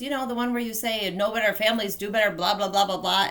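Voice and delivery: fast, loud, run-together rattle; sister's rapid mimicry. Sister mimicking a too-quick recording, rapid and loud. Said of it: "mimicked the same thing back to me".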